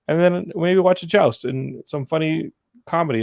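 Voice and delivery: ordinary talk, nothing marked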